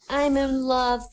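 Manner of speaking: high, slow mock boy voice; little schoolboy. Boy confessing to his pretty teacher, high little-boy voice. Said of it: "the boy said".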